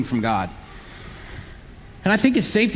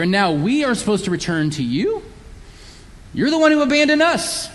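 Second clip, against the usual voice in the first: higher, sneering complaint with a mocking edge; sneering sarcasm; 5 semitones higher than usual. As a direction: throw it back at God, indignant and scornful. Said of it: "It's kind of just a sneering sarcasm".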